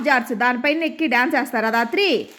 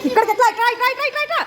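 higher coaxing sing-song; coaxing high call; 10 semitones higher, much faster than usual. Real, not acted. Father coaxing his little one to come, high sing-song.

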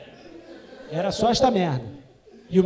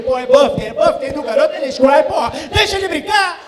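mock frantic plea; 5 semitones higher, 8 dB louder than usual. Dad begging Darth Vader, loud.

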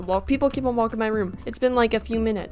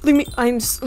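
usual speaking voice

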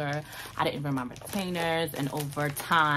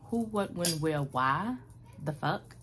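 normal talking voice

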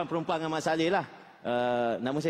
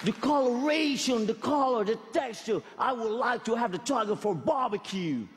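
high-pitched, hammed-up Australian; Australian slang accent; 7 semitones higher, slower than usual. Shown as a demonstration. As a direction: play an excited Australian wildlife spotter. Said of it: "an Australian English slang".

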